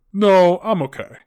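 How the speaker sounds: loud, drawn-out dismissive refusal; snapping. Acted. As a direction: cut in as a curt queue-jumper. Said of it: "he snaps at me".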